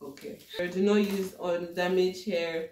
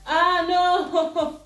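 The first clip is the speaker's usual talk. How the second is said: high, loud cry of alarm; dismayed; 12 semitones higher, 8 dB louder, slower than usual. Real, not acted. Vlogger as her hair comes loose, dismayed. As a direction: wail it high and loud, breaking into laughter.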